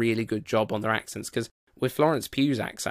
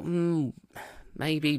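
higher, drawn-out doubtful hmm; doubtful musing; 6 semitones higher than usual. Retold or imitated. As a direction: mull it over, unconvinced. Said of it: "at first I thought".

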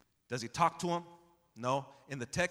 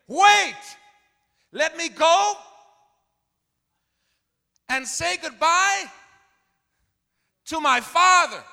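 Elisha cries out high and loud, slow on the last words; shouted plea; 15 dB louder, slower than usual. Acted. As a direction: call out desperately, high and strong. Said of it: "what does Elisha say to him?".

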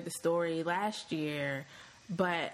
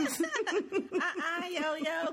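mock scolding mother; 9 semitones higher, slower than usual. Co-host playing the scolding mother.

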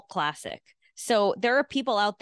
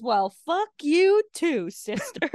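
slow, higher mock Peter comeback; imitating Peter; 7 semitones higher, slower than usual. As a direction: drag out the insult like the unhinged hero. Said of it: "He goes".

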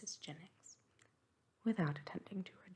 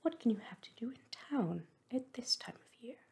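higher-pitched, astonished questioning; incredulous cry, pitched up; exclaimed question; 5 semitones higher, 4 dB louder than usual. A narrator's reading. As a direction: press the question with mild astonishment. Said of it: "cried Missus Jennings".

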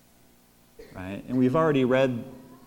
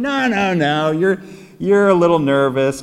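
louder, mock brush-off of a worrier; dismissive; 5 semitones higher, 6 dB louder than usual. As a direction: wave away someone's fears. Friend brushes off his fear.

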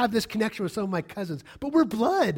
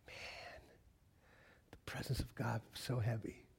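very soft, low, near whisper; hushed awe; 9 semitones lower, 17 dB quieter than usual. Retold or imitated. Preacher reliving God's heavy presence, hushed awe. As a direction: breathe it out as if overwhelmed.

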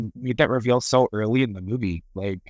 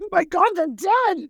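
much higher, squealed mock panic; shocked squeal. Imitating the dad discovering the guards are dead, high shocked squeal. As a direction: squeal it like a startled old man. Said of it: "he's like".

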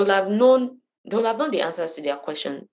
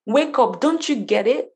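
raised, higher urging voice; assertive wake-up call; 5 semitones higher, 4 dB louder than usual. Acted. Imitating Jesus crying out to the crowd, assertive raised voice. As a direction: call out to jolt them awake. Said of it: "speaking in that voice of".

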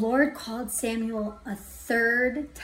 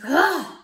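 loud, higher exasperated "ugh"; frustrated groan; 5 semitones higher, 8 dB louder than usual. Retold or imitated. Driver throwing the dead phone, frustrated groan. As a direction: let out a burst of frustration. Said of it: "So in frustration, I threw it".

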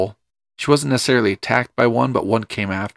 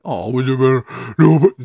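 put-on silly baby talk to a dog; stupid dog voice. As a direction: coo at a barking dog, playful. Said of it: "do the stupid dog voice".

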